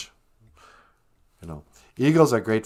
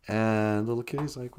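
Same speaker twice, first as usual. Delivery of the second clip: ordinary delivery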